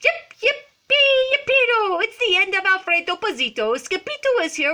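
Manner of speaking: high, loud yipping celebration; jubilant cheering. A narrator's reading. Chihuahua band celebrates Skipito's arrival, hammed-up jubilant yelps. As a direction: whoop like excited little dogs.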